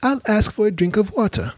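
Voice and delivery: high, strained voice of a sick man; sick plotting man. Acted. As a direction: scheme through the illness. Shadik answers.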